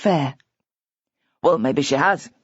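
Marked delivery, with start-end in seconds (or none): 1.4-2.3 growled. A narrator's reading. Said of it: "growled Glenn".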